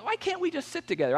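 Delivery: sudden high-pitched whine of frustration; upset squeak. Retold or imitated. Complaining to the agent in a high, exasperated whine. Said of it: "I'm getting all upset".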